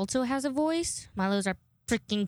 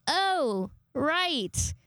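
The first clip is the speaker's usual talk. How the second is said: mock realization; 6 semitones higher than usual. Acted sudden realization.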